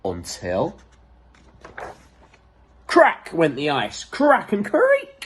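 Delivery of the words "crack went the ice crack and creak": high, slow creak; dramatic creak. Acted. Reader making the ice creak, drawn out high. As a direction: stretch the creak out like breaking ice.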